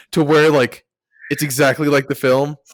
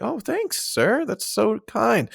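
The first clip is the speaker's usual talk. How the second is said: high, soft, polite voice; small sweet put-on thanks, slowing at the end; timid grateful student; 7 semitones higher, 5 dB quieter, slower than usual. Flattered student thanks his teacher, high and soft. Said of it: "Be like".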